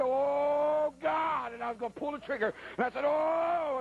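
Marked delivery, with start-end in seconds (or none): 0.0-1.5 high, drawn-out mock cry; terrified wail. Hunter facing charging bear, hammed-up terrified cry. Hunter crying out before firing. 3.0-3.8 high, long hammed-up howl; terrified wail. Hunter about to fire at bear, hammed-up howl. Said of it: "I scared the bear".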